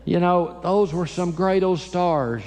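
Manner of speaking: usual speaking voice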